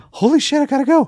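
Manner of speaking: high, loud comic alarm; hammed-up panicked exit line; mock panic. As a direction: blurt it like someone about to bolt. Acts Gutenberg panicking to flee.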